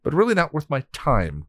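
dismissive sneer; lightly voiced haughty boredom; taunting. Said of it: "Glory taunted".

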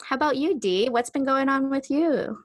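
ordinary delivery

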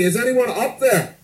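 high, pleading shout; desperate yell. Retold or imitated. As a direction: call up into the void, frantic. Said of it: "he yells up".

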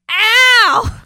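Satan punched, hammed-up yelp; mock yelp.

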